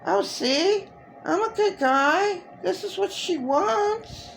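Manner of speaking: high-pitched parody of a pretend good guy; mock nice-guy pretending. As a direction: mimic his fake niceness in a high, mocking voice. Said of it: "he just pretends".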